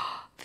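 sharp mock gasp; staged gasp. Mom pretending to discover the baskets, a staged gasp. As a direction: suck in air in fake surprise.

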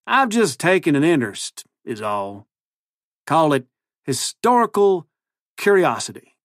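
high-pitched folksy cowboy twang; cowboy accent. A narrator's reading. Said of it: "his ridiculous new accent".

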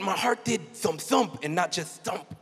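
usual speaking voice

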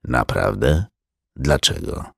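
a touch of a low, curious man; Strike's voice. A narrator's reading. (niższy, cichy głos detektywa)

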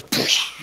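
voiceless whooshing explosion sound; imitated blast noise. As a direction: mimic rocks blasting apart with breath. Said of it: "God comes by and goes".

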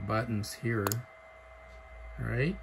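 ordinary delivery

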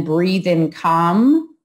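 each word drawn out over a slow inhale; slow meditation cue; slow demo. Shown as a demonstration. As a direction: stretch "breathe in calm" out long and easy. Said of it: "can you get a full breath in".

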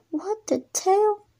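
high, slow drawn-out disbelief; can't believe what just happened; baffled. Acted. Girl reacting in slow disbelief to the odd visit.

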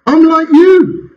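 high, drawn-out insistence; slow, high-pitched retold objection; retold earnest protest. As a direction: stretch it out high, imitating your own disbelief. Retells protest to hand kisser.

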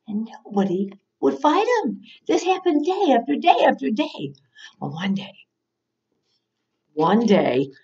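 ordinary delivery

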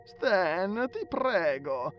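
high, drawn-out whine; whining plea. A narrator's reading. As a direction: beg your friend in a loud moan. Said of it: "si stava lagnando l'amico".

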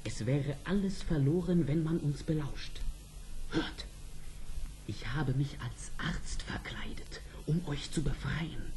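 gedämpft und leiser werdend bis zum Flüstern; leise. Acted. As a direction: leise sprechen, damit niemand lauscht. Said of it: "Aber still jetzt". (der Prinz flüstert der Prinzessin seinen Plan zu)

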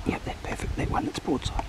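breathy hunter's whisper. Real, not acted. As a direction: whisper so the deer won't spook. Whispering before the shot.

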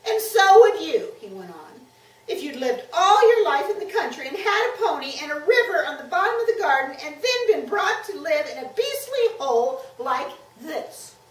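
put-on high, loud, wound-up child; upset boy's defiant tirade; loud, miserable outburst. A narrator's reading. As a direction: pour it out loudly, past caring. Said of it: "in a much louder voice, like a boy who was so miserable".

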